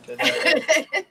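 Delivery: squealing high laugh. Real, not acted. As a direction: laugh through the words. Laughing at the joke.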